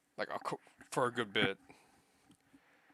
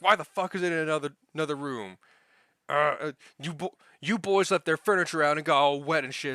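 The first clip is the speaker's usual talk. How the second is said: imitating a worked-up boss, louder and higher; raised-voice retold telling-off, pitched up; chewing out; 5 semitones higher, 6 dB louder than usual. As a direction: act out an irate boss laying into the workers. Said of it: "started chewing my ass out again".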